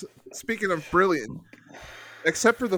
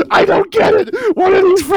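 high, loud acted wail; meltdown; 14 semitones higher, 10 dB louder than usual. Comic retelling of a child's Christmas morning meltdown. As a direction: shout it in a mock meltdown. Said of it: "having a, a meltdown".

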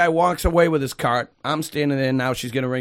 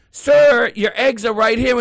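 higher, louder imitated announcement; calling out; 7 semitones higher, 5 dB louder, slower than usual. Cashier to customer over found eggs, calling out.

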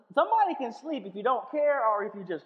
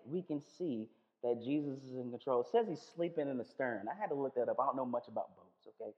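ordinary delivery

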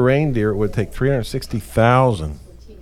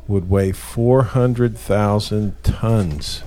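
slow emphatic; much slower than usual. Real, not acted. Slow emphatic big number.

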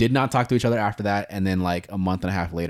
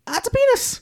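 high blunt nurse voice. Retold or imitated. Quoting blunt ultrasound tech.

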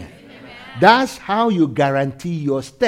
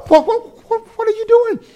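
mock surprise; 6 dB louder than usual. Mock question to congregation.

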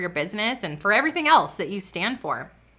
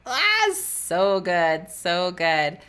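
savoring; much slower than usual. Real, not acted. Savoring the scripture.

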